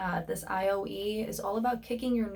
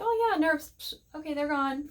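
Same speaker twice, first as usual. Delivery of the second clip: higher, airy brush-off of the nerves; mock breezy dismissal; 7 semitones higher than usual. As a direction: pretend the nerves vanish with a careless shrug. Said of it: "to actually be like".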